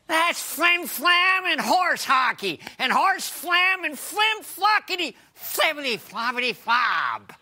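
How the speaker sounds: blustering nonsense rant; indignant sputter. Acted. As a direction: sputter it like a huffy cartoon.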